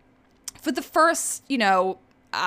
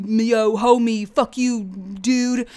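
bro voice; much slower than usual. Retold or imitated. Host mocking bros bickering, light bro voice. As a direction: toss it off like a frat bro. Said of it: "dudes being fucking bros".

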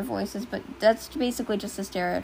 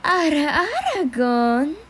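louder, teasing anime purr; a hammed-up sultry anime tease; 5 semitones higher, 6 dB louder than usual. Shown as a demonstration.

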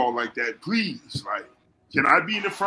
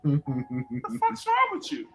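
exasperated friend; 5 semitones higher, slower than usual. Retold or imitated.

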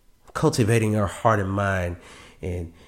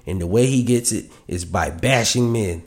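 ordinary talk, nothing marked

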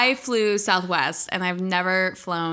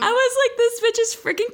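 laughing; 14 semitones higher than usual. Real, not acted. Laughing while quoting.

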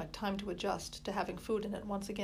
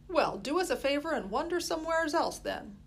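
higher, louder brusque Molly; retort; 7 semitones higher, 5 dB louder than usual. A narrator's reading. Busy woman brushing off the boy, higher brusque retort. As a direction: snap back at the cheeky boy. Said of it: "Molly retorted".

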